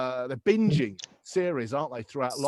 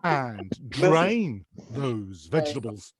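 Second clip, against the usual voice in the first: Gordon Ramsay; 2 semitones lower, slower than usual. Acted. Famous chef coaching at the urinal, slow cookery instruction. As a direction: dictate each step like a recipe. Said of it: "visions of him telling me what to do".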